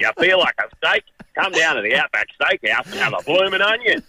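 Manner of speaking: high, hammed-up Australian ad voice; extra Aussie. Shown as a demonstration. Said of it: "make it a little bit more Aussie".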